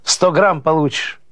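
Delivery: slow, high teasing answer; playful drawn-out character voice; amused reply. A narrator's reading. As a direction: draw it out high, in jest. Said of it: "Смеётся Павло".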